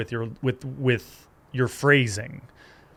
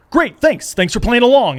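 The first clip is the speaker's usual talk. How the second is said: much higher, louder, bitter thanks; sarcastic angry thanks; 9 semitones higher, 7 dB louder than usual. Retold or imitated. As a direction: snap out a fake thanks in anger. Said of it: "And he got so mad".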